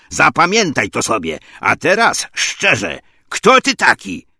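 loud warning, rising to a high demand; forceful officer losing patience; stern interrogation. Acted. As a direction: press the prisoner hard for the truth. Acted angry interrogation of a prisoner.

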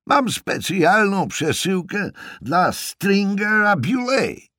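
high, loud, slow holler; stretched-out yell to a deaf listener; shouted explanation. Acted. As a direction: shout each word so she hears. George shouting at deaf aunt.

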